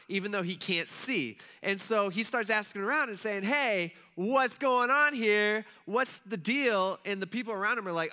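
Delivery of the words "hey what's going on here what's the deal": raised, slow calling out to the crowd; a blind beggar calling out, louder and higher. Retold or imitated. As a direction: call over the noise, drawn out. Blind beggar asks around.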